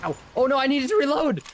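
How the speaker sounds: high, loud panicked yelp; panicking gamer. Real, not acted. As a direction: shriek it as the enemies close in. Gamer caught mid-fight without ammo.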